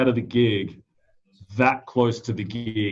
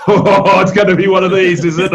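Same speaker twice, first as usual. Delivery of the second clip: much louder, higher, sarcastic; sarcastic resignation; 6 semitones higher, 9 dB louder than usual. Retold or imitated. Session drummer facing another messy gig, loud sarcasm. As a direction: greet the disaster with loud mock cheer. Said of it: "where it's like".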